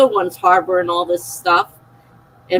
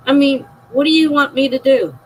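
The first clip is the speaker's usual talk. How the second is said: higher and louder, throwing up her hands in frustration; exasperated; 6 semitones higher, 5 dB louder than usual. Real, not acted. Mother venting about her daughter, exasperated and louder. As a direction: demand an answer, fed up.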